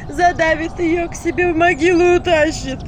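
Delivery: keen like a frightened peasant mother; loud village wail; lamenting woman. A narrator's reading. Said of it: "причитала женщина под дверью".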